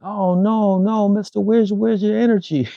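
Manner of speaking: high-pitched insistent kid impression; young girl. Kid pressing her host after his surgery, high child voice. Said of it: "She was just like".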